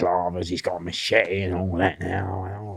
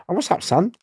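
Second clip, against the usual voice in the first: concerned mate; 10 semitones higher than usual. Retold or imitated. Asks troubled mate in car.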